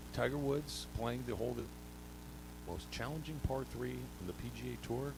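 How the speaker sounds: hushed golf call with long dramatic pauses; golf announcer with pauses. Pretend. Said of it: "you need a few long pauses in there".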